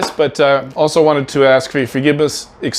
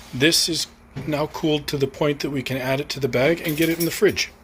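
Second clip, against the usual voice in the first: ordinary talk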